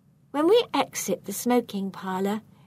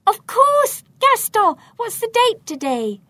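loud, high burst of realization; sudden cry; 13 semitones higher, 8 dB louder than usual. A narrator's reading. Burglar struck by an idea at the safe, crying out. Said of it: "Suddenly I cry out".

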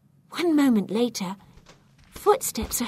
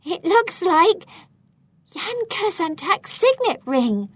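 high, urgent, awed exclamation; excitement and fear; 9 semitones higher, 5 dB louder than usual. A narrator's reading. Telling partner whose ring it is, excited and fearful. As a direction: reveal the find, thrilled yet fearful. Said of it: "with excitement and fear mingling in my voice".

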